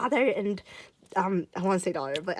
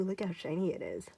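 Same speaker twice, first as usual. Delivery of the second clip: ordinary talk, nothing marked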